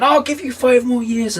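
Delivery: very high desperate give-in; desperate devil. Acted. Devil giving John five years, high and desperate. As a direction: cave in with a squeal.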